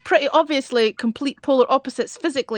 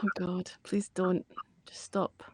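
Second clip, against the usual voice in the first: hushed Scottish groan, barely voiced; exasperated whisper; 7 semitones lower, 15 dB quieter than usual. Real, not acted. Friend whispers in embarrassment at the Scottish bit. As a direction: beg him under your breath to stop. Pleading with co-host to stop.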